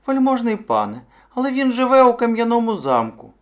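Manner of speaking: very high little hare voice; high-pitched timid hare; cautioning hare. A narrator's reading. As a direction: object meekly in a high small voice.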